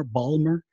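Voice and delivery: "Balmer" cut to two syllables, no t; Maryland pronunciation. Shown as a demonstration. Repeating the Maryland accent example, "Balmer" in two syllables. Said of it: "They say Balmer instead of Baltimore".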